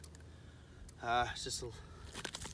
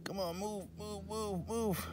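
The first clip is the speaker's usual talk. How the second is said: soft, high-pitched coaxing; will it along, high and quiet; hushed, repeated pleading; urging under the breath; 6 semitones higher, 8 dB quieter than usual. Real, not acted. Urging the flag line to move.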